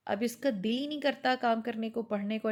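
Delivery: usual speaking voice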